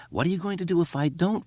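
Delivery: high, fast taunt; mocking high-pitched challenge; bullying jeer. A narrator's reading. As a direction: rattle off the dare. Bully jeers at Eddie.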